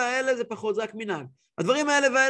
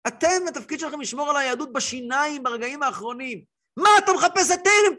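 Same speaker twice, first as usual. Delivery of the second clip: impassioned rabbi; 6 semitones higher than usual. Retold or imitated. Rabbi explains, rising to passion.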